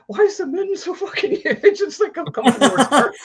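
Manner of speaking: very high voice breaking into laughter; laughing incredulity. Pretend. Joking with friends about the movie's huge moon, laughing. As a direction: marvel in a squeaky, giggling mock protest.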